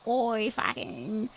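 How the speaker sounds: broad Australian Bogan impression; Bogan accent. Shown as a demonstration. Australian demonstrating a servo run for co-host, typical Bogan accent. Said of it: "the typical Bogan accent".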